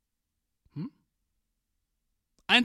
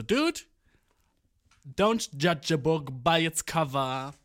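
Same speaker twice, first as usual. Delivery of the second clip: mock wise; slower than usual. Quoting himself to Steffen.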